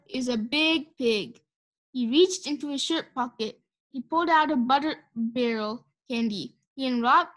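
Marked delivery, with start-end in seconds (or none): 0.1-1.4 slow, awed cowboy voice; slow cowboy drawl. A narrator's reading. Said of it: "whispered Leroy".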